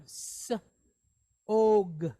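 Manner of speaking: "s", pause, then "og"; sounded out. Shown as a demonstration. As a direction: separate the hissed "s" from a drawn-out "og". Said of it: "sound like a soft G".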